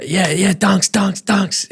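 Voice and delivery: shouting a name on the field; mock teammate call. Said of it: "players listen out for teammates' voices".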